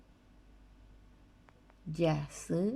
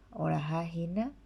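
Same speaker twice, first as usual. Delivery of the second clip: ordinary talk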